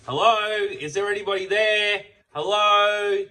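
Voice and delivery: hollering into an empty shop; retold shouting out. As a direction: bloke calling out for the missing servo attendant. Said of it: "was shouting out to the servo attendant".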